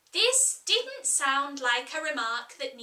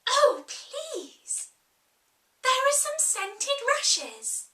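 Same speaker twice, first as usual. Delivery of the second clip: much higher, louder, thrilled cries; sudden delight; 12 semitones higher, 4 dB louder, slower than usual. Acted. Alice spotting the scented rushes, high and thrilled. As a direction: burst out with childish joy. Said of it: "in a sudden transport of delight".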